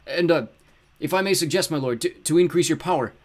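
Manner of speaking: high, loud, hesitant flattery; obsequious rooster henchman. A narrator's reading. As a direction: stammer a suggestion to your master. Henchman nervously offers a suggestion.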